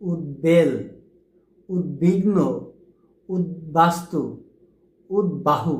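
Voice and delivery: উদ্বেল said "ut-bel" with a clear b; each word said ut plus a clear b; correct pronunciations. Shown as a demonstration. Teacher gives the final correct forms slowly. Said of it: "শুদ্ধ উচ্চারণ কী হবে?".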